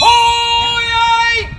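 loud ringing crier's cry; town crier call. Shown as a demonstration. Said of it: "I do the first two oh yeas".

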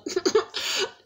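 high unvoiced giggle; high airy giggle; breathy giggle. Real, not acted. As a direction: giggle softly at her own begging. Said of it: "begging joke, laughing".

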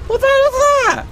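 very high shocked cry. Real, not acted. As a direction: yelp in disbelief. Shock at sight.

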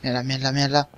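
cursing under breath. Real, not acted. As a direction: curse quietly to yourself. Se esconde del enemigo. (maldiciendo entre dientes)

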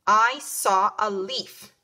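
I saw a leaf, spoken very slowly and clearly; slow model sentence. Shown as a demonstration. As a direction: read the sentence slowly for little kids. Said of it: "putting some of these new words in a sentence".

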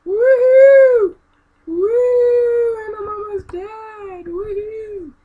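very high, loud whooping; wild cheer. Real, not acted. Gamer whooping through a huge jump, very high cheer. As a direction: whoop like you're flying off a ramp.

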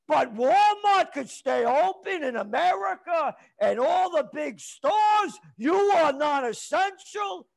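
furious, slowed shouting; outraged shout. Real, not acted. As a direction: shout the unfairness at them. Outraged at store closures.

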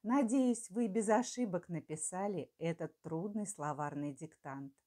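normal talking voice

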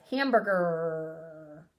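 slow "Hamburger" with the final R drawn out; stretched word. Shown as a demonstration. Teacher stretching the word to show its last sound. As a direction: draw out "Hamburger" so the ending is heard. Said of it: "What does hamburger end with?".

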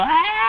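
high shouted yelp; mock scream. Cousin getting whooped, high mock scream. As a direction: yell out high like being whooped.

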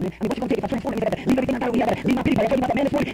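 usual speaking voice

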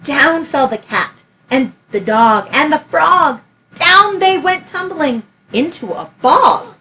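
put-on lively read-aloud for a child; story narration. A narrator's reading. Teacher reading Room on the Broom aloud, lively storytelling. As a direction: tell it like a bedtime picture book. Reads picture book aloud.

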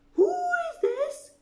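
very high, loud imperious queen; haughty queen voice. A narrator's reading. Queen asks the knave, imperious and high. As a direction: play the Queen of Hearts demanding. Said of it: "The queen said".

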